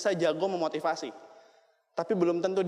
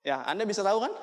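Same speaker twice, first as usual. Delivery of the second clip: ordinary talk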